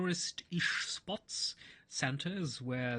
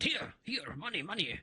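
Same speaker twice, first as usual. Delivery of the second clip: playing dumb; 6 semitones higher than usual. German man offers coins.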